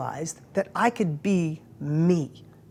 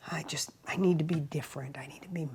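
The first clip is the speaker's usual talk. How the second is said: retold shy whisper, voiceless and soft; 4 semitones lower, 10 dB quieter than usual. Shy guy at the mastermind whispering his wish. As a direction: whisper it like a shy man admitting a need. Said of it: "he's whispering".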